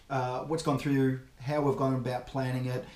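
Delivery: ordinary talk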